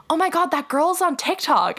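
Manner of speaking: high-pitched quoted exclamation; imitated excited fan. Stranger behind her outside the bar, excited. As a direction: pitch it up like a fan spotting someone.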